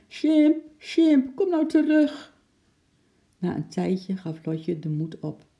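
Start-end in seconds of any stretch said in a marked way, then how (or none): 0.1-2.4 high, loud, anxious call; calling out pleadingly. A narrator's reading. Anxious Lotje calls her runaway animal, high and loud. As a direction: call a lost pet back. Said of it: "ze riep keer op keer".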